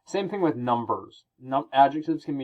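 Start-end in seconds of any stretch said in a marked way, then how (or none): none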